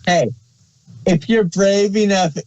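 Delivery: ordinary talk, nothing marked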